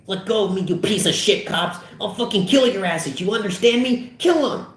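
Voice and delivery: high frantic fury climbing to a squeal; wild high-pitched tirade; raging threats. Acted. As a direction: rant while struggling to break free. Fogel fakes violent struggle.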